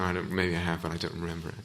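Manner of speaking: usual speaking voice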